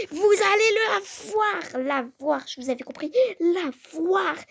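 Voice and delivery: high, loud yelled warning; shouted threat. Acted. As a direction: yell it right in her face. Menace criée pendant la dispute. (menace hurlée, aiguë et forte)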